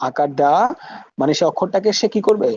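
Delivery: ordinary talk, nothing marked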